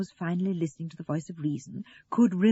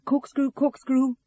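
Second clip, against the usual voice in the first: raised voice, higher and slow; Russell searching; 7 semitones higher, 6 dB louder, slower than usual. A narrator's reading. Russell hunting for a corkscrew, calling out high. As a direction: call it out slowly, high and loud.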